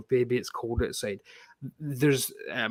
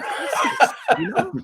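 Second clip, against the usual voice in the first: laughing shout. Real, not acted. Laughing outrage at cover.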